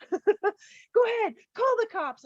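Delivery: high, drawn-out mock voice taunting; imitating his taunt, high and slow. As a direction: stretch it out high, sneering. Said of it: "He literally started yelling".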